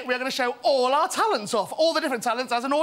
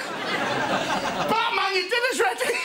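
put-on higher mum calling a kid in; calling kids to dinner; 7 semitones higher, much slower than usual. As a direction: call him in for dinner like a mum.

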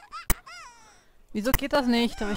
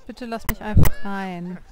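much louder, insistent "bitte lass mich einfach rein"; louder plea; 10 dB louder than usual. Real, not acted. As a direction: raise the voice, begging to be let in. Pleading again, louder.

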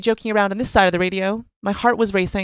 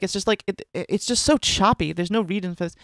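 normal talking voice

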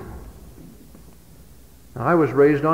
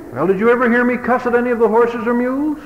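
quick, raised father's cross-question; stern father; 7 semitones higher, faster than usual. Retold or imitated. Father cross-examining his son, fast and raised. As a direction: rattle the question off fast and high. Father confronts boy over cussing.

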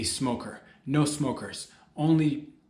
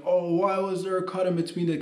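whiny viewer; 6 semitones higher than usual. Retold or imitated. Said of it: "I don't want it to be like".

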